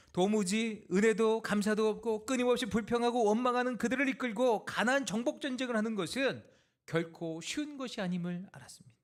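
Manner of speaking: high, loud, driving sermon delivery; loud, impassioned preacher; forceful preaching. Acted. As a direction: drive the list home from the pulpit.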